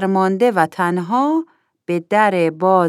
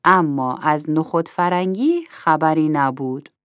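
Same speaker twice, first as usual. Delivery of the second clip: ordinary delivery, nothing marked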